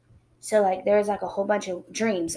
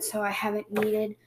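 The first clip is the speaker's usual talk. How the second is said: ordinary delivery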